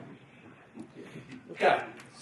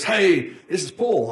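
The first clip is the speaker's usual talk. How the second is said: enthusiastic; 5 semitones higher, 4 dB louder, slower than usual. Retold or imitated. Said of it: "he's really enthusiastic".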